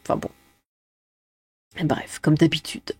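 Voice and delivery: unvoiced resigned whisper; whispered aside. Real, not acted. (chuchoté, las)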